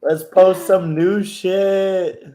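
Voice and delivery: slow, drawn-out mocking echo. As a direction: drag it out to tease him. Mocking S1's line.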